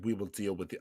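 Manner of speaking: half-hearted try at Vader's menace; low would-be Vader; attempted Vader. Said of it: "a try to be Vader".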